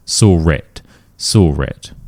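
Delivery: "Sorit" as saw with an R joined to it; intrusive R. Shown as a demonstration. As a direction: say "Sorit" with an added R. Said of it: "adding an R sound".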